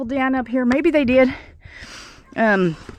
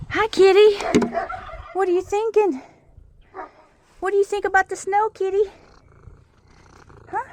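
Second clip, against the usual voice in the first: much higher pet-talk voice; much higher sing-song voice for the cat; 10 semitones higher than usual. Real, not acted. Greeting the cat in the snow.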